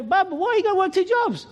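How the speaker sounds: high, put-on animated voice; retold incredulous question. Acting his question to guard.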